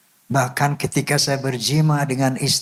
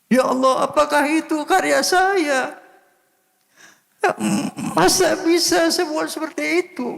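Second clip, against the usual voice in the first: very high, tearful cry of disbelief; overcome, crying out to God in wonder; 13 semitones higher than usual. Retold or imitated. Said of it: "Saya menangis".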